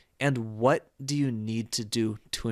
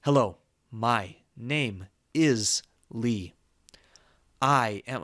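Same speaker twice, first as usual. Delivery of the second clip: slow, each word separated like a robot; robotic, unconnected speech; 2 semitones lower, slower than usual. Shown as a demonstration. Teacher showing learners speech that's not connected, robotic. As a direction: say every word on its own, unconnected. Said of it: "I sound like a robot".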